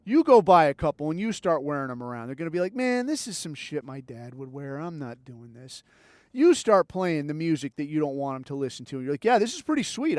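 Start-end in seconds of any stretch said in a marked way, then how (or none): 2.7-5.8 soft, dismissive kid imitation; low-key mock refusal; mock disgruntled kid. Voices teen rejecting dad's shirts. 9.2-10.2 higher-pitched mock enthusiasm; put-on approving parent; feigned approval. Voices parent feigning liking music.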